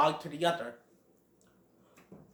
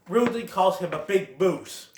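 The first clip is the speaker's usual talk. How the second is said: usual speaking voice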